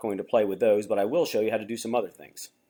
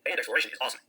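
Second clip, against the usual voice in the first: sped up; 4 dB quieter, much faster than usual. Shown as a demonstration. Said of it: "This will sound like you, just faster".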